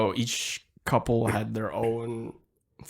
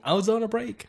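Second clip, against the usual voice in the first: higher-pitched quoted catchphrase; mock protest; 11 semitones higher than usual. Quoting the cheating excuse from Friends, mock protest. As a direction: mimic an indignant sitcom line, higher.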